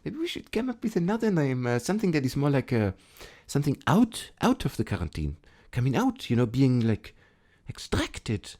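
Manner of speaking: hesitant, soft put-on French accent. Board member timidly suggesting a new game name, French accent. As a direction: fumble for words in a mock French accent. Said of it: "should I do the French accent".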